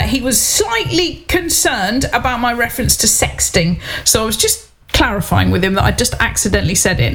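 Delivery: ordinary talk, nothing marked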